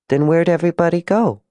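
put-on high child voice, asking; innocent, high-pitched question; puzzled little girl. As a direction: ask as a baffled small child.

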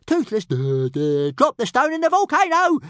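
high, loud, stuttering dragon voice; stammering little dragon. A narrator's reading. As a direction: trip over the words like an excited little dragon. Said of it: "stammered Toothless".

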